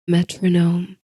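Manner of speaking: ordinary delivery, nothing marked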